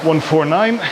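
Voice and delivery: breathless while rowing; puffing between words mid-workout; out of breath. Real, not acted. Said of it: "Need to breathe".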